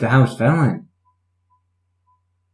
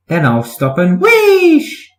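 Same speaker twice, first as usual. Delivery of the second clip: wheesh; 11 semitones higher, 4 dB louder, slower than usual. A narrator's reading. Engine imitates steam whoosh.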